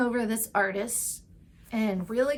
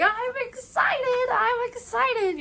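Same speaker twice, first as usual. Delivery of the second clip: much higher, slow fake-cheerful voice; mock excitement; 13 semitones higher, 3 dB louder, slower than usual. Editor hyping a terrible rewrite, mock excited. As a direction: sing it out with forced cheer. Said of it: "He's like".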